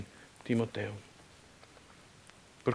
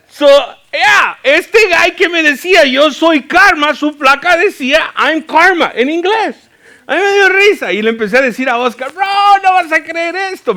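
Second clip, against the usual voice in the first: high-pitched, shouted storytelling climax; squealing-high, very loud amazement; excited disbelief; 13 semitones higher, 12 dB louder than usual. Retold or imitated. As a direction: shout it out, incredulous and amused. Amazed at the plate coincidence.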